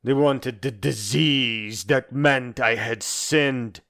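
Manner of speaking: usual speaking voice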